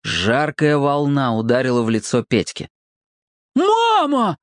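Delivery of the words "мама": high long cry; boy yelling. A narrator's reading. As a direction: Petka crying out to mother. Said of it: "взревел он". (громкий детский вопль)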